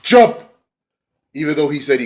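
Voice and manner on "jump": much higher and louder yelled order; mock command. Matt urging Brother Nero to leap, high loud mock shout. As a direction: shout him off the ladder. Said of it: "she's like".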